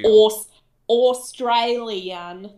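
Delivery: very slow, overstretched Australian vowels; mocking bad Australian accent. Showing co-host how they sound, mocking fake Australian accent. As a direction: drag out a botched Australian accent. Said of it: "That's what you sound like to me".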